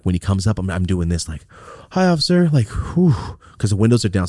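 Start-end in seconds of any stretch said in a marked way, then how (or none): 1.9-3.1 high, sweet innocent hi; butter up the officer, all innocence; playing innocent. Greeting the cop with a joint hidden, high fake innocence. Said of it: "trying to play it to, like, innocence".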